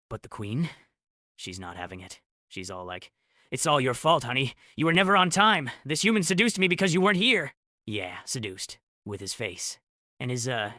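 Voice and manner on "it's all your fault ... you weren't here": high, loud indignant outburst; shriek-pitched, loud accusation at a husband; angry blaming. A narrator's reading. Queen blaming her husband for her affair, indignant.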